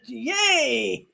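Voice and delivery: a little pretend yay; mock excited cheer. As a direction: cheer as if thrilled. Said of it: "I'd be all excited".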